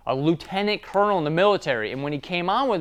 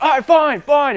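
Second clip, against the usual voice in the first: loud, higher grudging shout; angry giving in; 10 semitones higher, 8 dB louder than usual. Retold or imitated. Employee grudgingly giving in to his boss, loud angry shout. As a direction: give in while still shouting. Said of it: "yelling back and forth".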